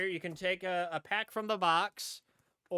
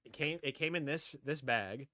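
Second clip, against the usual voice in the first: usual speaking voice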